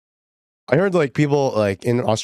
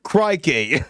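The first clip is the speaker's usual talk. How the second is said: a quick playful "Crikey" in a light Aussie voice; mock Australian; 6 semitones higher than usual. Streamer trying Aussie phrases for chat, mock accent. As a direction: toss off a cartoon Aussie "Crikey". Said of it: "what's things, like, Australians say?".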